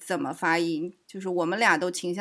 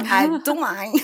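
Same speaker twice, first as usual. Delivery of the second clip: higher, louder, through laughter; laughing; 7 semitones higher, 7 dB louder, slower than usual. Real, not acted. Telling an absent classmate she doesn't care, laughing. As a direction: brush it off with a loud laugh.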